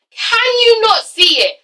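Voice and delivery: slow, high-pitched cry of disbelief; escalating exasperation. Acted. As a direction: push the question high and slow. Pleading with Amber, repeated more intensely.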